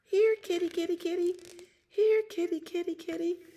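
very high, sing-song kitty call; little girl calling. Acted. Little girl calling her kitty to bed, high-pitched coaxing. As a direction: coax the cat in a tiny high voice.

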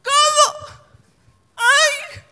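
high shrieking cry of shock; screamed shock. Retold or imitated. A woman in the crowd shrieks at her, high and startled. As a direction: scream it like an audience member stunned mid-show. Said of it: "me gritó".

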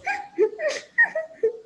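very high tiny giggling; little cookies giggling together; giggles. Acted. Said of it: "she heard more giggles".

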